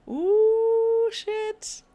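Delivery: sudden high, loud yelp of shock; startled yelp. Real, not acted. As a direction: jump out of your skin and swear. Startled by a game event.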